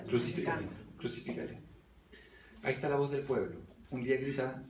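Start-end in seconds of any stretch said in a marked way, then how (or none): none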